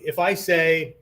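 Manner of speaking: ordinary delivery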